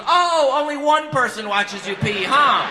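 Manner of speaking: high, loud, drawn-out mock outrage; sarcastic envy. Friend's wife hearing of rehab drug tests, sarcastic envy. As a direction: stretch it out in loud, jealous sarcasm. Envious mother mocks rehab complaint.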